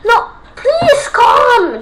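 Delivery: high laughing yell at the screen; half-laughing plea, shouted; nervous laughing shout; panicked shout. Real, not acted. Panicked gamer pleading with game.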